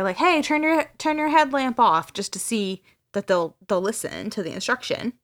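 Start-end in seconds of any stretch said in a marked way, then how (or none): 0.2-2.1 higher, calling across the dark; retold yell, half-hearted; mock shout. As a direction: call out to a distant light. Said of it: "he's, like, shouting over there".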